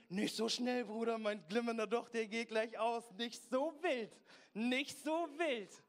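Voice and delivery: loud, high-pitched cry of protest; mock alarmed shout. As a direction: yell in hammed-up alarm at someone rushing past. Mocking a timid believer calling out. (gespielt entsetztes, lautes Rufen)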